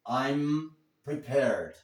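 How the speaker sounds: drawn-out, careful English; deliberate teacher's demonstration; slow model sentence. Shown as a demonstration. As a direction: dictate it slowly for learners. Slowly models corrected sentence.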